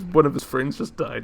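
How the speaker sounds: ordinary talk, nothing marked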